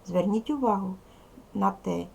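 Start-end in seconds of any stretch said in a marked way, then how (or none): none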